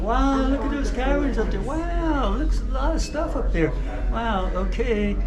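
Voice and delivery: high, awed and amazed; awed wow. Real, not acted. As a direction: gasp in wonder at the view. Awed by the caverns.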